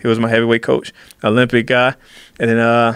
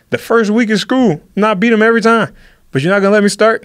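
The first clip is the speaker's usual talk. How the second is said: indignant protest; 8 semitones higher than usual. Acted. Acted argument with coach.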